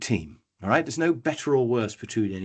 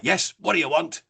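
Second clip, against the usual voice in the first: controller; 6 semitones higher than usual. Acted. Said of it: "The controller's like".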